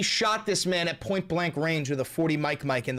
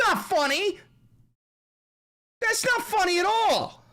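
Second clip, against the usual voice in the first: much higher, whiny mock outrage; whiny outraged caricature; 6 dB louder, slower than usual. Streamer mocking an offended figure, whiny high outrage. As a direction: squeal like someone offended by a meme.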